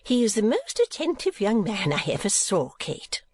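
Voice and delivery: high, animated doting mother; gushing Mrs. Nickleby. A narrator's reading. Mother praising a young man to Kate, gushing. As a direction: praise him in a high, fussy voice.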